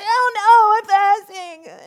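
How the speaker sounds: hammed-up high, loud wail; mock dismay. Woman caught at the fridge mid-fast, mock wail. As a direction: play the panic at the fridge big.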